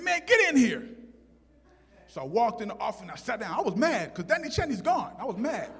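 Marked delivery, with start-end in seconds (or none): 0.0-0.9 loud, high-pitched order; imitated principal hollering. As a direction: summon a pupil as an annoyed principal. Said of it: "the principal said".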